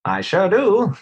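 high, bright vintage announcer voice; old-timey radio voice. Shown as a demonstration. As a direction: ham it up like a 1920s radio host. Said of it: "Do you have a good old-timey radio voice?".

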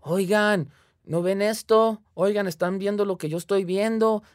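calling out loud and high in alarm; retold shout. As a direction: yell to the others, frightened. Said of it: "empiezo a gritar bien, bien alto". (gritando asustado, fuerte y agudo)

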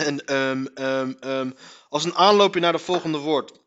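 usual speaking voice

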